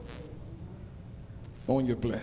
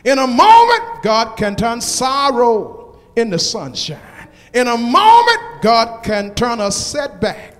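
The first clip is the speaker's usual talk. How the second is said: loud rising preacher's call, building; preaching at full force; 2 semitones higher, 7 dB louder, slower than usual. Acted. Pastor calling out to the congregation, preaching at full force. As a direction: whoop the promises at the crowd.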